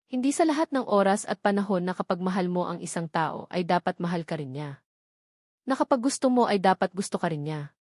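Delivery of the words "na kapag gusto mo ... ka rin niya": ordinary delivery, nothing marked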